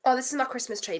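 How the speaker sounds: usual speaking voice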